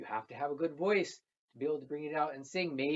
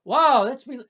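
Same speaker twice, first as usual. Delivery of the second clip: much higher, much louder wow; amazed; 11 semitones higher, 17 dB louder than usual. Acted. Girl tastes the fern, much louder in amazement. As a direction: burst out in wonder.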